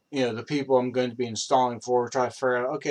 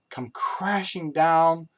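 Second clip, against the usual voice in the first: ordinary delivery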